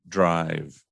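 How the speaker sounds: normal talking voice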